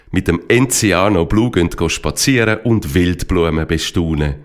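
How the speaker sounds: ordinary delivery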